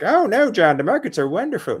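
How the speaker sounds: high, sarcastic mock voice of a cheerful doubter; mock an imagined critic in a high, lilting voice; mock naysayer. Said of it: "dispute that and be like".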